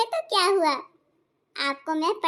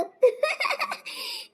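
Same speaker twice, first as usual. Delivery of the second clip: put-on a quick rising girlish giggle; high giggle; 11 semitones higher, 3 dB quieter than usual. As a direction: giggle smugly at her own luck. New bride boasting, giggles. (ऊँची खिलखिलाहट)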